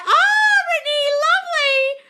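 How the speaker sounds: very high, loud delighted cry; excited greeting. Real, not acted. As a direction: squeal the name with delight. Greeting viewer in live chat.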